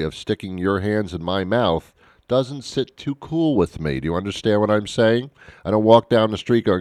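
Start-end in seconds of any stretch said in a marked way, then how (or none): none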